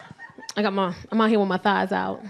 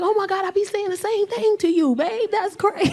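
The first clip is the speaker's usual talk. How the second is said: high excited voice; 9 semitones higher than usual. Retold or imitated. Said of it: "I'm like".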